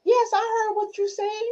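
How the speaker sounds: very high-pitched acted answer; playful high-voiced protest; mock insistent reply. Acts the listener's reply.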